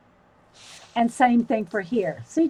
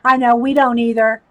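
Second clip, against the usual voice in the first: ordinary talk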